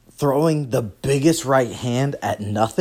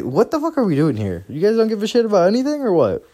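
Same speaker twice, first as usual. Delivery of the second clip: incredulous; 10 semitones higher than usual. Retold or imitated. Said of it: "I was like".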